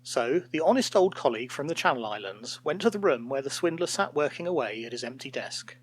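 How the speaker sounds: plain reading voice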